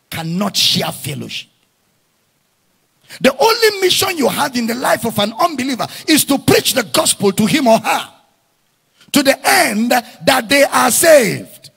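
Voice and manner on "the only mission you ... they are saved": loud rising preacher's cry, hammering each line; preacher shouting to his congregation, fervent and loud; full-force preaching. Acted.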